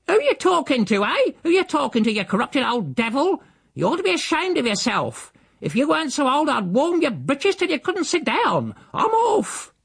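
high, screeching tirade; furious scolding. A narrator's reading. Housekeeper scolds the uncle before storming out, furious screech. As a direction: rail at someone in shrieking outrage.

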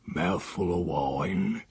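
a conspiratorial hush, drawn out; whispered. Acted. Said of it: "he whispered".

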